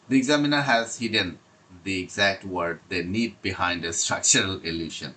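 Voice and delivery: slow, deliberate reading of prepared notes; slow read-aloud. Acted. Teacher reading exam tips to students, slow and deliberate. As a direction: read each phrase out carefully, like a script.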